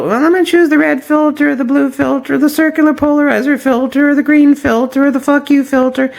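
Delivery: high, pompous mock voice; mocking gear snob. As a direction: parody of a fussy amateur. Mocking a gear-obsessed amateur.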